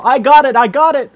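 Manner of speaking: loud eager claiming; excited shouting. Pretend. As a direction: shout it like you won. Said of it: "three people".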